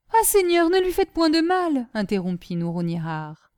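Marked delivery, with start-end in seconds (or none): 0.1-1.9 higher, lightly voiced anxious plea; supplication inquiète. A narrator's reading. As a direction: cut in, pleading gently for mercy. Nouronihar interrompt Vathek pour plaider. (supplication un peu alarmée, plus aiguë)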